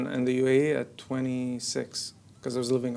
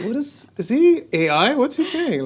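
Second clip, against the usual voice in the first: 1 confused onlookers; 12 semitones higher, 8 dB louder than usual. Retold or imitated. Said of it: "They're like".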